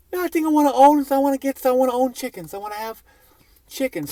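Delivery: high, airy mock of the chicken neighbors. Comic telling the crowd, mocking neighbors who want chickens, high and silly.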